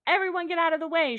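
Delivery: quick, high, urgent shout; shouted emergency warning; paramedic shouting. Acted. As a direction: clear the path with a loud call. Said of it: "shouted the paramedic".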